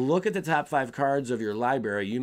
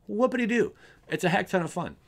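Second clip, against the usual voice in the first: sarcastic; 8 semitones higher than usual. Real, not acted. Sarcastic cheer about infinite combo.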